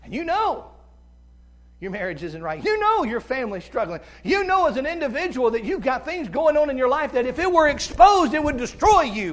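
high, loud fiery sermon climax; full-force preaching. Acted. Preacher confronting the congregation about hidden sin, fiery and loud. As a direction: hammer the truth home from the pulpit.

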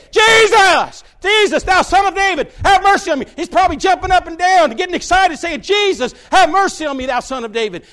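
loud high cries of Jesus, again and again; desperate shout. Retold or imitated. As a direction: call out to be heard over a crowd. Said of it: "standing up there saying".

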